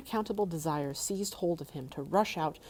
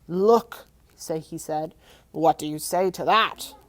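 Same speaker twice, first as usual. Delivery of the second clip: proud dragon; 9 dB louder, slower than usual. Acted. Dragon showing off.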